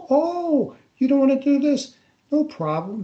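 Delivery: high, sing-song sarcastic "Oh, you don't wanna do this?"; mock sarcastic surprise. Officer mocking the reluctant witness, sarcastic high voice. Mocking the interrogator's fake kindness.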